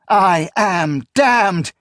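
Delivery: slow high loud despairing cry; anguished cry. A narrator's reading. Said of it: "she cried".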